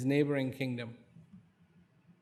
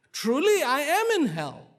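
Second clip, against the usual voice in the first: higher, louder anguished realisation; overwhelmed realization; 13 semitones higher, 8 dB louder than usual. Acted. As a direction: cry it out as the truth hits him.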